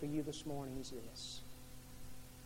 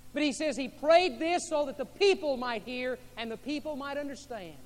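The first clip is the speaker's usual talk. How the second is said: loud, high impassioned preaching; rising pulpit fervour; preaching at full force; 8 semitones higher, 8 dB louder than usual. Acted. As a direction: preach it out with fire. Preaching to congregation.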